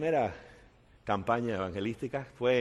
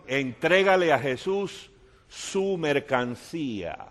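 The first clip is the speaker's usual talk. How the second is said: slow appeal; 4 dB louder, slower than usual. Acted.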